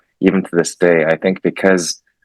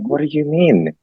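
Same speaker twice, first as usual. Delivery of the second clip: put-on exasperated teen; 8 semitones higher than usual. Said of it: "And I'm like".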